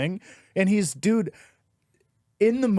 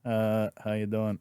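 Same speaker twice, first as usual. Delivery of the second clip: much lower, soft, slow half-hearted reply; reluctant crowd; 7 semitones lower, 6 dB quieter than usual. Retold or imitated. Awkward audience answering the comic, reluctant mumble. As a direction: mumble it back unwillingly. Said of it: "they were like".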